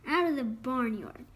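plain reading voice

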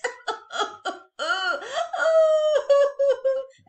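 high burst of laughter; storyteller laughing. Real, not acted. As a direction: laugh out loud. Narrator laughs.

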